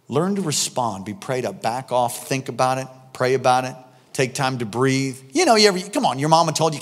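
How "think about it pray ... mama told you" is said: ordinary talk, nothing marked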